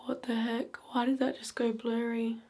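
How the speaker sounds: hushed, unnerved question; startled whisper. Real, not acted. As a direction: whisper it, spooked. Camera blurs during ghost session.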